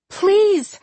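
high-pitched pleading cry; desperate cry. A narrator's reading. Charlie pleading for John's life, crying out desperately. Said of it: "Charlie cried".

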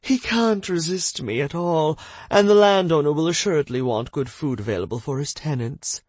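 flirty lady voice. Acted. Madame confident flirt.

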